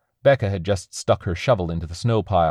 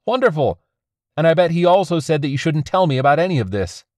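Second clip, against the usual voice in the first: upset man; 10 semitones higher, 4 dB louder than usual. A narrator's reading. Angry argument between partners.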